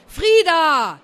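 loud, high yell of a name; cross shout. A narrator's reading. Aunt losing patience with her niece, cross shout. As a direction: shout for the child, properly fed up. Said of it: "jetzt war Tante Sigi wirklich langsam sauer".

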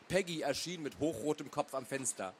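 ordinary delivery, nothing marked

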